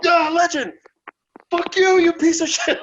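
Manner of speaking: loud, high mock heckle from the crowd; imitating fans yelling. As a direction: yell it like a heckling fan at the front. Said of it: "they jump up".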